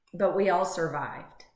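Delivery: usual speaking voice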